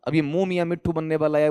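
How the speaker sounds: ordinary delivery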